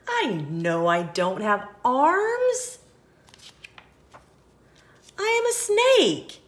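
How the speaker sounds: odd voice rising high and loud on snake. Acted. Snake explains himself, ending high. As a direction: build to a squeaky punchline. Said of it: "He says".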